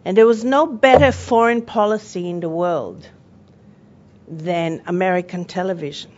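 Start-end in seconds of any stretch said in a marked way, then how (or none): none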